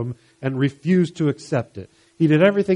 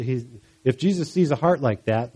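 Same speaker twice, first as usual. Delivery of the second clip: normal talking voice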